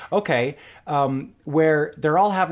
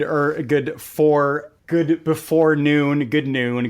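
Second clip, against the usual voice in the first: usual speaking voice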